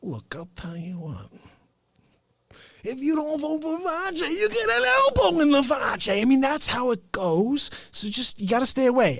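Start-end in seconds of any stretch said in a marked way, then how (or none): 0.0-1.3 hushed low menacing aside; low mock threat. 2.8-6.2 rising playful threat; mock threat.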